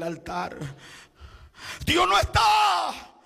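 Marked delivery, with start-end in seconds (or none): 1.9-3.1 "Dios no está" cried out high, loud and slow; high, loud, drawn-out "Dios no está"; shouted refrain. Acted. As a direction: hammer out "Dios no está" slowly at full voice.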